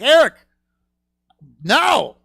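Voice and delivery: loud, high "Eric, no"; scolding shout. Retold or imitated. Brother scolding the joke, loud high shout. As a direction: call out to your brother in alarm. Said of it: "I was like".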